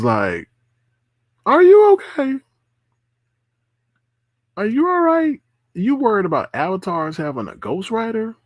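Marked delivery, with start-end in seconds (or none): 1.5-8.3 very high-pitched disbelieving questions; mock incredulous. Asking him if he's okay over avatar worries, mock incredulous. Retold reaction, questioning his sanity.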